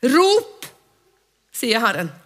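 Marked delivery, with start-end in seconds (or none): 0.1-0.7 high, loud single cry; call out. Shown as a demonstration. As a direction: shout the word like a summons. Roper Guds befaling. (høyt rop)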